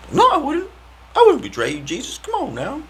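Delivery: high, whiny mock protest; mock denial. Disciple swearing to Jesus he'd never betray him, high and mocking. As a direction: squeak out a fake-innocent denial.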